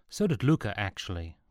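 plain reading voice

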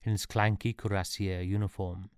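ordinary delivery, nothing marked

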